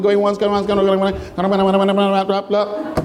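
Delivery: rapid auctioneer patter. Pretend. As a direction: rattle it off like an auctioneer. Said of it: "I sound like an auctioneer now".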